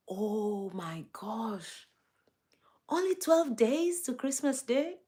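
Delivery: slow, breathy gasp of disbelief; hushed shock. Real, not acted. Streamer realising only twelve days to Christmas, hushed shock.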